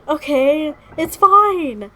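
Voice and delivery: very high, loud, unconvinced reassurance; high-pitched, loud protest of being okay; overwhelmed insistence. Real, not acted. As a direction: rise to a loud wail while insisting. Insisting she is fine, upset.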